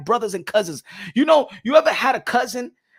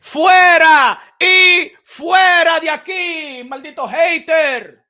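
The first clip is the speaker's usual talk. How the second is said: slow, much higher and louder yelling; furious shout; 8 semitones higher, 11 dB louder, much slower than usual. Acted. Yelling at a troll in the chat to get out, furious shout. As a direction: bark him out of the room, slowly.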